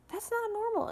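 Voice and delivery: re-enacted high-pitched objection; indignant protest. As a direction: pitch jumping high on every word. Retold quarrel, indignant conclusion.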